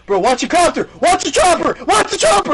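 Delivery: high, loud panicked warning; mock freaking out. Player on building warns helicopter pilots, panicked yell. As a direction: yell it with climbing, imitated panic. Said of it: "This dude was freaking out".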